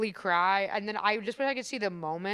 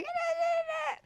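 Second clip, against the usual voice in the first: much higher, half-sobbed nah nah nah; mock crying whine; 5 dB quieter, much slower than usual. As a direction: whimper it like a pretend sob. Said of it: "when I'm sad, I'll be like".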